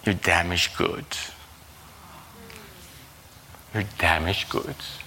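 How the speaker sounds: low, slightly softened tempter voice; lightly voiced low accuser; the enemy's accusation. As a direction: lay the accusation down low and slowly. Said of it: "And the enemy just".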